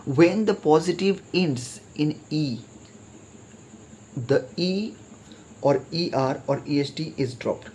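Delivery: slow, measured reading of the rule; teacher reading a grammar rule aloud; slow textbook reading. Shown as a demonstration. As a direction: read the rule out slowly for students to follow. Reads rule from the book.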